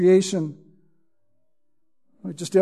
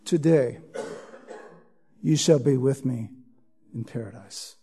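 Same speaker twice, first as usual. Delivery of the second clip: hushed solemn promise; 8 semitones lower, 9 dB quieter than usual. Acted. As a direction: let each phrase sink away into a hush. Promise quoted again in sermon.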